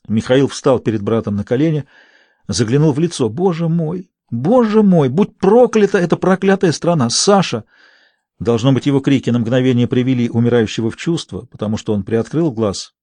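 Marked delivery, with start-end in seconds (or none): 3.3-7.7 anguished cries. A narrator's reading. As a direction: cry out in grief over brother. (горестный крик над умирающим братом)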